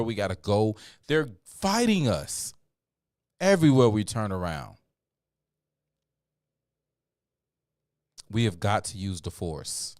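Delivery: fiery street preacher, building loud; rally the people to fight back; rousing speech. Acted.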